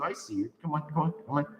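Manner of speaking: ordinary delivery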